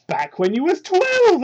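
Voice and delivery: a sarcastic high-voiced jab; mocking high aside. Real, not acted. Reader mocks Sonic's backstory in a high voice.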